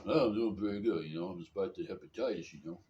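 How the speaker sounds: mumbling boxer impression; Rocky Balboa. Sick man telling Jim about hepatitis, boxer impression. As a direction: play a punchy, good-natured movie boxer. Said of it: "start sounding like Rocky Balboa".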